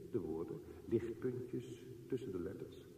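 normal talking voice

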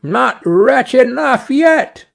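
high, slow taunt; storybook bird's vengeful retort; taunting sparrow. A narrator's reading. Sparrow taunts the carter again.

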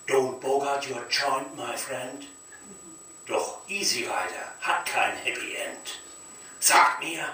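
normal talking voice

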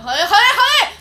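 loud, high curses through laughter; laughing loud curse. Real, not acted. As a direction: burst out cursing with a laugh. Bursts out laughing in frustration.

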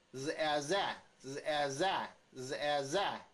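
日哎在 as buzzed z, eh, then punched za; sound blending. Shown as a demonstration. Teacher shows the sound of Z, blending drill. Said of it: "Z的声音是日".

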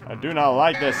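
usual speaking voice

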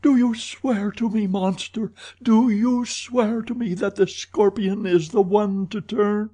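high-pitched, urgent demand; crying out. A narrator's reading. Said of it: "cried Christine".

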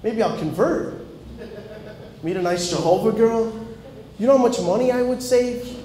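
dawning glee at saving money, pitch climbing; mock excited realization. To the Jehovah lady, giddy about skipping gifts, rising excitement. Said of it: "I'm like".

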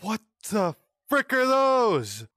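usual speaking voice

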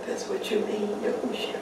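breathed softly, unvoiced; whispered. Real, not acted. Praying, whispering intimately to Yahushua. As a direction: drop to a hushed whisper.

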